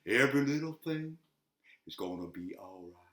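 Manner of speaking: sung line fading to almost nothing; mock studio fade-out. Shown as a demonstration. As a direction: sink to silence like a studio fade. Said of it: "I'm fading it out".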